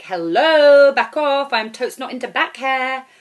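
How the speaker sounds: high, loud brush-off; mock telling-off. Girl recalls telling a clingy guy off, loud and high. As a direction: shoo him off loudly.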